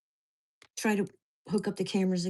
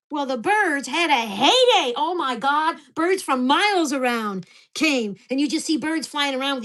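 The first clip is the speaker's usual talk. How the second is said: incredulous rant; 4 semitones higher, 5 dB louder than usual. Real, not acted. Mocking the fish stocking.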